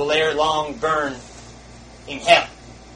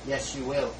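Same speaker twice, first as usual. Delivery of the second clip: quiet; 7 dB quieter than usual. Real, not acted. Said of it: "repeated line, soft take".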